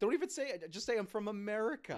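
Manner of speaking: loud, high-pitched incredulous yelling; exasperated comic shout. Real, not acted. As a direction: yell in outraged disbelief. Comic rant at the filmmakers.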